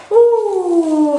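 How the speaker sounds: a high, loud squeal of delight; excited ooh. Real, not acted. Vlogger opening her new camera, a high loud excited ooh.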